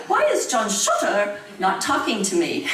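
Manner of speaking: high-pitched little boy with a put-on British accent; mock British accent. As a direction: imitate a son's British-accented complaint to Mama. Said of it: "he had a British accent".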